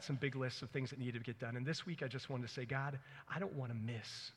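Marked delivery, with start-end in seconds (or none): none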